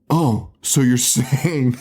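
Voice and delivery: slow, drawn-out dawning "oh, so you're saying"; gasp, then spell out the realization slowly; mock gasp of realization. Hoping the girl catches on, slow mock gasp. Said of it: "I was really hoping for her to be like".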